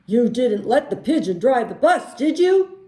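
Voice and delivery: loud questioning bus driver, rising at the end. Acted. Bus driver back and checking on us, loud rising question. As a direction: ask loudly, lifting the tag question high. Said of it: "And he says".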